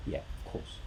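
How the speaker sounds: breathy whispered aside; unvoiced whispered yes. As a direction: whisper it like a secret. Answering whether hunger is true.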